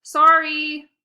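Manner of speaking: plain reading voice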